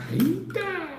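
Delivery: startled high-pitched "eita"; high squeak. Acted. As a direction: squeal it in mock shock. Reaction to topic.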